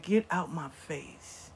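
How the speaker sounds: slow, quiet disgusted dismissal; low-key, drawn-out contempt. Retold or imitated. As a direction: drag it out softly in retold disgust. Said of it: "he was so disgusted".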